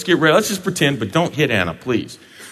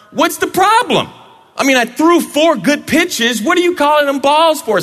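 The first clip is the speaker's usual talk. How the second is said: much higher, protesting pitcher voice; indignant pitcher; 11 semitones higher than usual. Acted. Pitcher Brett protesting to umpire Anna, high indignant complaint.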